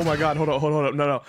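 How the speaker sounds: ordinary delivery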